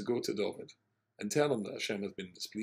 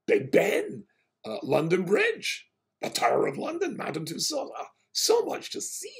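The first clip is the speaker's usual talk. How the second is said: much higher and louder, slow and sing-song; excited listing; 13 semitones higher, 8 dB louder than usual. Retold or imitated. Rabbi teases the passenger about London sights, excited tour-guide gush.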